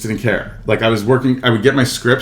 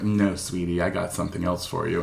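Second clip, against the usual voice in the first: soft, low condescending let-down; gentle brush-off; 4 semitones lower, 11 dB quieter than usual. Acted. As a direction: turn them down softly and sweetly. Casting director gently turning him down.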